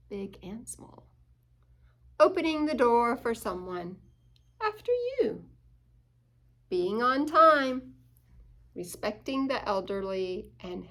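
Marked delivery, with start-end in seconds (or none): 4.6-5.4 high, polite after you; polite offer. Acted. Reader voicing a polite otter at a door. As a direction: sweetly wave someone through.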